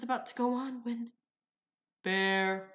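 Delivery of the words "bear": barista calling order. Retold or imitated. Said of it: "the second barista calls".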